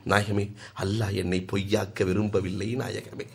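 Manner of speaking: soft, choked tearful plea. A narrator's reading. Companion pleading tearfully to the Prophet, soft and choked. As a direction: plead quietly through tears. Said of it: "கண்ணீர் மல்க".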